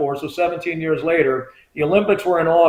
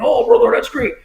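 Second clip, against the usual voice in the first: high-pitched wrestler impersonation; big wrestler greeting a fan, imitated; Hulk Hogan; 9 semitones higher than usual. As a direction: put on a larger-than-life wrestler voice. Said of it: "he talks like he does".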